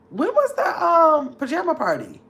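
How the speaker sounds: high, puzzled questioning; confused. Real, not acted. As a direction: trail off and restart in bewilderment. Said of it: "I'm confused now".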